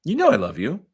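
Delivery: mildly dismissive dad voice; quoting her dad. Retold or imitated. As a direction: toss it off like a dad brushing her aside. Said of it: "She's saying, what he said is".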